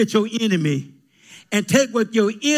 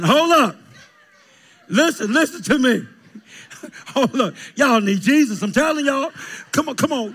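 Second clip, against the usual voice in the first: ordinary talk, nothing marked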